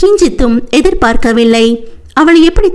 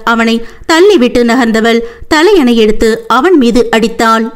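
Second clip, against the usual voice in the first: usual speaking voice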